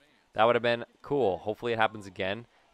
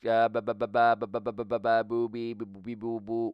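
rapid rhythmic ba-ba and boo-bee babble; babbling filler noises. Real, not acted. Player scanning the game log, babbling absently. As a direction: burble nonsense syllables while searching, fading off.

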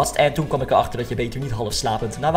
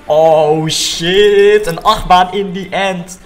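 loud startled yell, pitched up; excited shout; 5 semitones higher, 7 dB louder, much slower than usual. Real, not acted. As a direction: blurt it out in shock.

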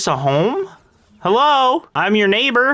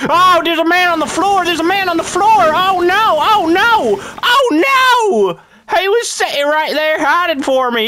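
high, loud startled cries of oh no; panicked; 8 semitones higher, 3 dB louder than usual. Acted. Farmer character panicking at a zombie on the floor. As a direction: yelp in alarm as a zombie strikes.

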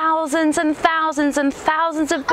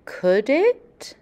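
picture book story narration; storybook reading; 6 semitones lower than usual. Acted. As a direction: tell it gently to a class of kids. Reads picture book aloud.

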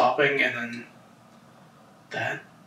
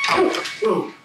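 startled; 5 semitones higher than usual. Real, not acted. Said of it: "That made me jump".

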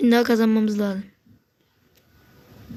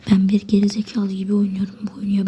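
whispered aside. Real, not acted. Frustrated aside about his play.

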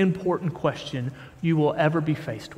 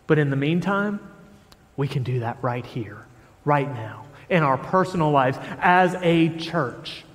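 ordinary talk, nothing marked

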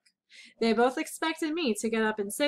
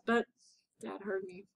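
usual speaking voice

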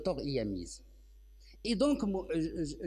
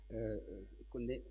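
ordinary talk

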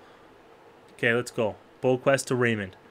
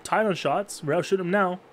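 normal talking voice

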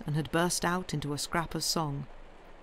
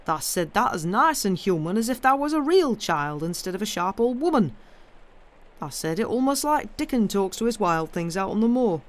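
put-on louder, animated old Yorkshireman; blunt Yorkshire gardener, surprised; 3 semitones higher, 4 dB louder than usual. Gardener amazed the girl spoke so kindly, animated Yorkshire. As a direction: marvel in broad Yorkshire.

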